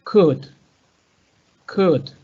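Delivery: "could" said as kud, no L sound; could without L. Shown as a demonstration. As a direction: drop the L in "could". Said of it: "to delete the pronunciation of the L".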